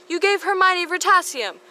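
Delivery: high, loud shared accusation; mock outraged unison. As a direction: accuse him together. Pansy and Harry accusing.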